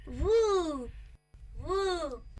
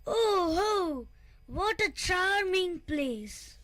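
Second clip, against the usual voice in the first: a touch of a thrilled cry, slow; half-hearted delighted shout; mild excited scream; 3 semitones higher, much slower than usual. A narrator's reading. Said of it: "Whooper screams loudly".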